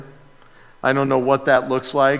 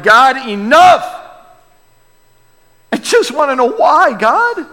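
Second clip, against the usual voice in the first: anguished shout; 11 semitones higher, 7 dB louder than usual. Retold or imitated. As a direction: shout your frustration at God. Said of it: "we're like".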